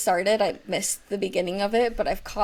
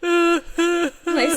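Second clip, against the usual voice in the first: slow, higher, hammed-up weepy confession; mock crying; 9 semitones higher, 6 dB louder than usual. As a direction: pretend to wail for laughs. Jokes about the daily cry.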